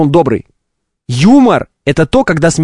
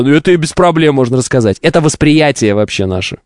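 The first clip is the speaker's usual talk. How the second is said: ordinary talk, nothing marked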